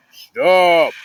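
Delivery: loud, firm command; a ghost orders the fleeing buddies to stop; commanding shout. Acted. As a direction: order them to halt. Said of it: "one commanded".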